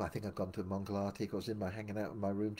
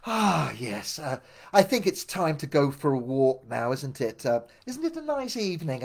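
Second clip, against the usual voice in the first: louder, airy mock-casual leader voice; breezy subject change; 4 semitones higher, 7 dB louder than usual. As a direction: breeze past the awkwardness, a bit hammed-up. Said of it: "Bhavananda kind of just went".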